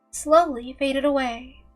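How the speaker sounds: usual speaking voice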